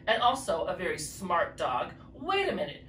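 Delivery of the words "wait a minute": sudden realization. Acted. Character has a sudden idea.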